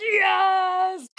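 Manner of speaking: high, loud, drawn-out cry of vindication; excited yes. Retold or imitated. Runner retelling her joy at a witness's backing, excited. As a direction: let out a long, triumphant "yes".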